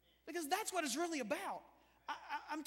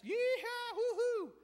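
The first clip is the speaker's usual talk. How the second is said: high, loud rowdy yee-haw; hammed-up party whoop; drunken partier whoop; 10 semitones higher, 5 dB louder, slower than usual. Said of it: "those guys that are partying".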